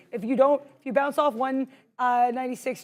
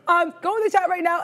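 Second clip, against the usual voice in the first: ordinary talk, nothing marked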